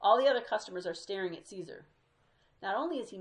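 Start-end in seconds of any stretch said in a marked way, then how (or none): none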